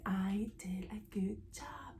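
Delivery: slow, playful whisper; whispered self-praise. Pretend. Sewist praising herself to viewers, whispered. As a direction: whisper it, drawing the words out.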